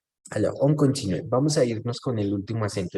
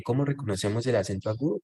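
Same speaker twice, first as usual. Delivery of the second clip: ordinary talk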